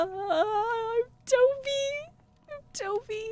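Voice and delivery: very high, stretched puppy voice; cute puppy voice. Pretend. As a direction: speak as a tiny eager puppy. Voicing the dog character.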